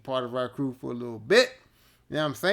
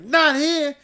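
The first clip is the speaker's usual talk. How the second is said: louder, higher flat no; stern refusal; 11 semitones higher, 9 dB louder than usual. Retold or imitated. Ramin refusing Scarborough the stall, loud stern no. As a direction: shut him down hard. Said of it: "Ramin was like".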